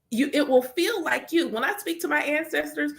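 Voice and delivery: usual speaking voice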